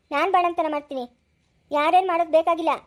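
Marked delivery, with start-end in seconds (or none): none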